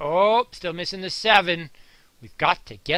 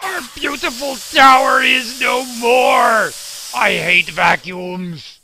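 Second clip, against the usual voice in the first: mock despair; 5 semitones higher, 8 dB louder, slower than usual. Toy tower knocked down by vacuum.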